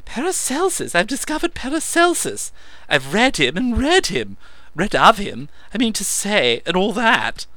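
high, loud raving; excited. A narrator's reading. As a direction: gush it out in a frenzy.

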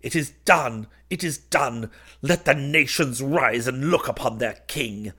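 exultant, high, loud proclamation; crying out. A narrator's reading. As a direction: cry it out in triumph. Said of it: "I entered crying".

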